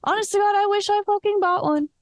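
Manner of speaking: high, fast exasperated regret. Real, not acted. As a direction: blurt out the regret in a rush. Regretting missing the concert.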